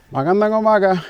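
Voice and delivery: magandang umaga sung out high and loud; greeting a passerby, high and loud; called-out greeting. Real, not acted. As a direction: call it out across the path.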